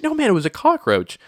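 high, loud protest; retold exasperated insistence. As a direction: insist to a friend who thinks it's a joke. Retold insistent correction of friend.

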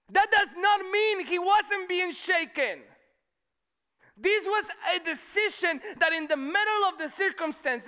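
high, loud preacher's shout; fervent shouted declaration; full-force preaching. Real, not acted. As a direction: proclaim it at the top of your voice.

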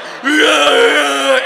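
long, high, loud mock wail; hammed-up full-body sobbing; imitated big-man crying. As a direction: bawl with everything engaged. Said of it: "that is how big men cry".

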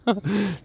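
quick high giggle; brief laugh at the own mix-up; short high laugh. Real, not acted. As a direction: burst out laughing at the flub.

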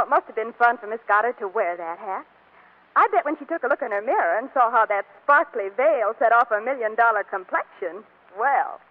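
normal talking voice

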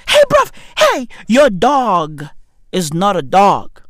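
ordinary talk

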